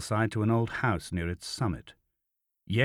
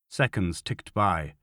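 plain reading voice

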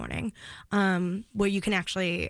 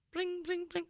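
1 mock phone ring; 9 semitones higher, 9 dB quieter than usual. Imitating dad's phone ringing.

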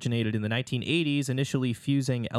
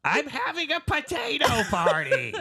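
Gilbert Gottfried voice. Acted. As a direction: screech it high like a comic. Joins the Gottfried impression.

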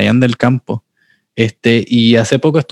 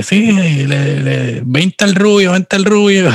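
cockfight bettor shout; 8 semitones higher, slower than usual. Retold or imitated.

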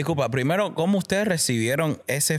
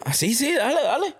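eager yes; 10 semitones higher than usual. Retold or imitated. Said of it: "Ernesto y yo dijimos".

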